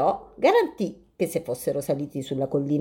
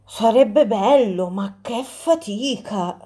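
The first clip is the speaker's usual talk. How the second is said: slow, tired little squirrels sighing; weary squirrels; 3 semitones higher, slower than usual. Acted. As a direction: drag it out, it all sounds exhausting.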